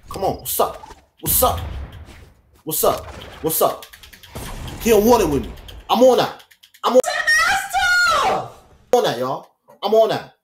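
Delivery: high, loud taunts; hype taunting. Real, not acted. Gamer taunts the opponent mid-fight, loud and high. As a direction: hype yourself up against the opponent.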